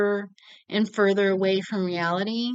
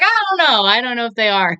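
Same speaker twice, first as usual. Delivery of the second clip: high, louder mock Phil voice; high mock dad's unsure reply; imitating Phil; 4 semitones higher, 8 dB louder, faster than usual. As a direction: toss off a lightly voiced shrug of a reply.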